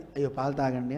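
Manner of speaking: low, soft "అయ్యో పాలు తాగండి" as a gentle offer; softly and kindly, host to guest. Shown as a demonstration. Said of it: "అర్థం మారిపోవట్లే".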